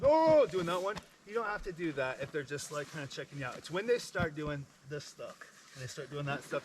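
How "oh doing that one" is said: high, loud startled oh; mock panicked gasp. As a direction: imitate a frightened visitor. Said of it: "people start freaking out and going".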